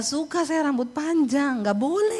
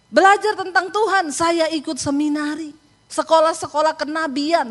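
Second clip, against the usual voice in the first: insistent boasting; 5 semitones higher, 4 dB louder than usual. Retold or imitated. Retold man boasting of his studies.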